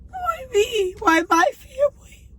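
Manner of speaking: high, tearful wail of a question; anguished crying plea. Real, not acted. Sick mother asking God why, sobbing high plea. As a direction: cry it out, pitch way up.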